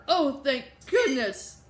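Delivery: slow, loud, frazzled relief. A narrator's reading. Late student after her paper is taken, frazzled relief. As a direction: let the relief out loudly, drawn out. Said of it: "the frazzled student said".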